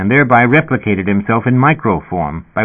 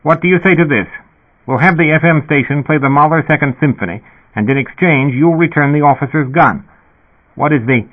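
higher intercom voice, trying to sound reasonable; a dispatcher bargaining patiently over the speaker; reasonable sputter; 6 semitones higher than usual. A narrator's reading. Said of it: "the speaker sputtered reasonably".

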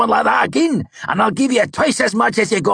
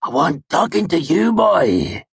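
higher, louder threatening northern growl; 12 semitones higher, 5 dB louder than usual. A narrator's reading. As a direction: warn the boy off. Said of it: "he growled".

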